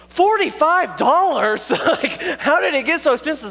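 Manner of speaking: high, slow, stunned disbelief; shocked at the bill. Retold or imitated. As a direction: gasp at the price like it's robbery.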